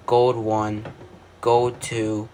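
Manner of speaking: ordinary delivery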